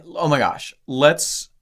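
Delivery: usual speaking voice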